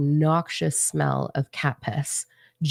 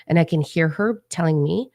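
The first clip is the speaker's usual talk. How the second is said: usual speaking voice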